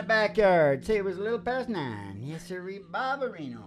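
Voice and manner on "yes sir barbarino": normal talking voice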